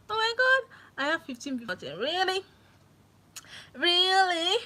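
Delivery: high, loud amazed "Really?"; real delighted surprise. Real, not acted. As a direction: squeal it out in happy disbelief. Sees fifteen viewers.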